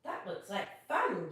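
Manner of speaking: ordinary delivery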